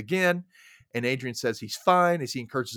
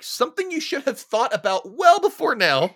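high, loud mock scolding; sarcastic scolding; 11 semitones higher, 5 dB louder than usual. Talking to the character, she scolds Kim with sarcasm. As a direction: scold her like she is an idiot. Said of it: "I'm like".

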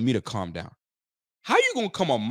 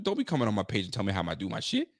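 ordinary talk

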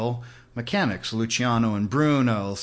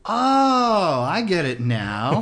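a big high "Oh" of sudden understanding, then a laugh; dawning realization; 6 semitones higher, 5 dB louder, much slower than usual. Retold or imitated. Realizing the mechanic meant training, a loud surprised oh. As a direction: let it dawn on you all at once. Finally understanding the dealership advice.

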